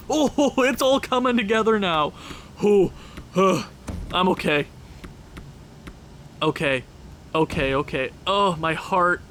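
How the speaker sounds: laughing, catching your breath; shaky laughing joy; giddy relief. Real, not acted.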